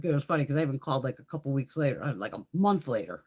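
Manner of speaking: ordinary talk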